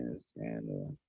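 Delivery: low, soft, barely voiced defeat; exhausted mumble. Real, not acted. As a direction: let the words sink away. Fading, tired ending.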